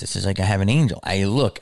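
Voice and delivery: ordinary delivery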